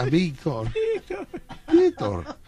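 a buried friend calling after him; dead friend calling his name from the grave; ghostly call. Retold or imitated.

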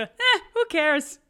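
high, slow laughing "Eh, who cares?"; mock carefree shrug.

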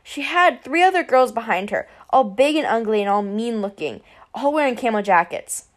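ordinary delivery, nothing marked

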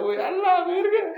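a sudden high cry of disgust; disgusted outburst. Pretend. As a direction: yelp at the gross idea. Imagines finger in tamal.

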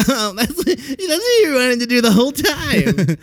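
high, slow, sing-song mock gasp of realization. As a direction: play the smug one who finally figured it out. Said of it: "anyone who's like".

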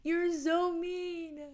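put-on high, slow little child voice, hurt; a wounded little girl asking why; inner child crying. Inner child pleading with the speaker, high and slow. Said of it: "She's like".

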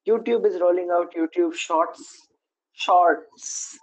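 drawn-out, deadpan mock enthusiasm; sarcastic. Real, not acted. Host announcing yet another TikTok clone, sarcastically. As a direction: draw out a fake, unimpressed cheer. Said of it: "you hear the sarcasm in my voice".

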